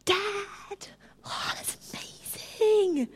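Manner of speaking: put-on high whispered child, slow. Child showing dad a gift, high and whispered. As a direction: whisper it like a delighted little kid. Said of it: "It's like kids".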